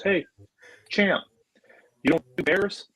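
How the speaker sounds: imitated movie star, high with pauses; Vince Vaughn. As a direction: needle him in a put-on high voice. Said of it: "just like he is in the movies".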